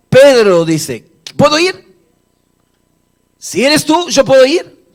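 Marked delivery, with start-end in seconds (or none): 1.4-4.9 high, loud, rising questions; eager plea. Acted. Peter asking Jesus to let him walk to him, eager acted plea. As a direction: ask keenly, voice pitched right up.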